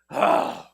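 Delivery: unvoiced loud groan of giving in; exasperated groan. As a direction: parent worn down by a begging kid, groaning loudly. Parent giving in to kid.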